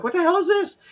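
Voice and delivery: high-pitched incredulous outburst; retold bafflement. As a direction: act out your baffled reaction, pitch jumping up. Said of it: "And I'm like".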